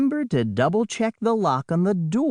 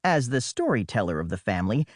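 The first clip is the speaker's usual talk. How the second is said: plain reading voice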